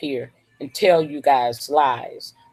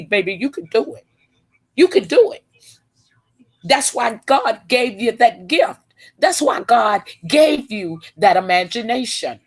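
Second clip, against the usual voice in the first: rising preacher's exhortation, higher and urgent; preaching; 5 semitones higher than usual. Real, not acted. Woman preaching to her viewers on a live stream. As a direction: call the listeners to believe, building fire.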